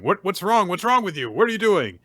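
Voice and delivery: loud, high-pitched scolding; alarmed guards. Retold or imitated. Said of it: "And they're saying".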